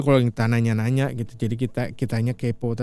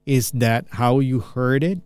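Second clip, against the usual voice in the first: slow, clear question read; slow model question; slowed down demo; slower than usual. Shown as a demonstration. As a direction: ask it slowly for learners. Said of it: "saya pelanin".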